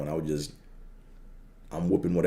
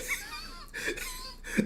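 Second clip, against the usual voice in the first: high laugh; 10 dB quieter than usual. Retold or imitated. Teammates laughing at his cut.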